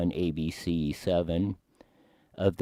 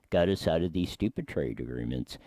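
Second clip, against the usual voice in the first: ordinary talk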